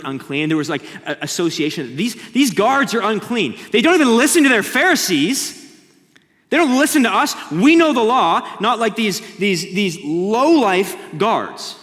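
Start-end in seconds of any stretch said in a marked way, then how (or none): 1.9-11.8 loud, high, sneering Pharisee voice; scornful Pharisees. Acted. Pharisees mocking the guards, loud sneering voice.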